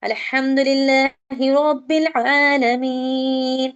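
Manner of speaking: slow measured recitation; Quran recitation. Shown as a demonstration. Teacher recites Fatiha verse to students, slow. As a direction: recite the verse slowly and carefully. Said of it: "यहाँ पे सूरह फातिहा पढ़ रहे हैं".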